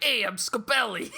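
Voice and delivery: put-on laughing caricature of an Italian accent; Italian guy. Mocking a film's Italian villain introducing himself, Italian accent. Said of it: "like, an Italian guy".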